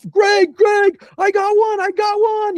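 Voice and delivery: very high, loud re-enacted holler; thrilled high-pitched yell; excited calling out. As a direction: shout the big news to your partner. Retells calling out news to Greg.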